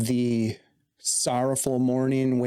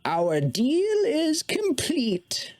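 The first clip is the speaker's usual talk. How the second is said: Mona's solemn voice; 13 semitones higher, much slower than usual. Acted. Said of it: "Mona looks to you and says".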